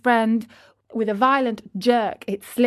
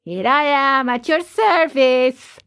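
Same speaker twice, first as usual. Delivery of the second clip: higher, slow, drawn-out reply; obliging offer; 4 semitones higher, 5 dB louder than usual. Acted. Carpenter offering Geppetto his service, obliging. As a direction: announce yourself with a flourish.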